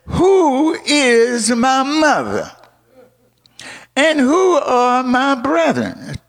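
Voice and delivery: high, drawn-out booming question; preacher's high, sustained cry as Jesus; forceful challenge. Retold or imitated. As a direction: ring it out slowly like a challenge. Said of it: "I never seen him talk like that".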